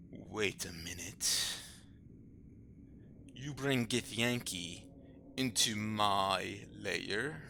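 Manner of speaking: slow, quiet menacing suspicion; slow suspicious. Acted. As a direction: drag out the realization darkly.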